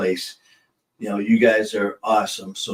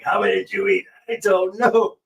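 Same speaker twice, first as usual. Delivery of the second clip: higher, put-on dazed buddy voice; stoned buddy voice; 9 semitones higher than usual. Retelling a stoned buddy to the co-host, higher put-on voice. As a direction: voice the high friend, lightly hammed. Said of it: "my buddy's like".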